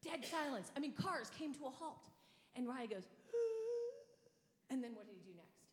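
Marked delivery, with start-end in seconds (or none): 3.1-4.3 long, high, loud intake of breath; imitated pre-wail gasp of a toddler; hurt child's gasp. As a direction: suck in air before a big cry. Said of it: "took that deep breath that when a little person gets hurt".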